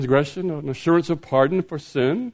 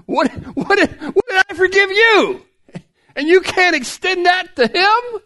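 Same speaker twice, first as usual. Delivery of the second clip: high, disbelieving outburst; incredulous king. Acted. King to the unforgiving servant, high and incredulous. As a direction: act out the king's shock at the ingratitude.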